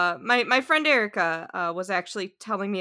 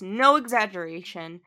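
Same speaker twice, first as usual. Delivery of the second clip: ordinary delivery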